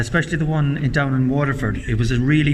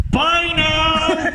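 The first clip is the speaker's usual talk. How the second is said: high, drawn-out call across a room; high-pitched held calling-out; shouted farewell. Retold or imitated. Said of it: "turns around and shouts out".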